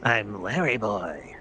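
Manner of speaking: slow, mock-heroic cartoon introduction; Larry boy. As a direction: drop it low and stretch the name out. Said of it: "Larry boy goes a little deeper".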